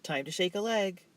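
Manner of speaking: brisk order to get up. A narrator's reading. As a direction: hurry him out of bed. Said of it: "she announced briskly".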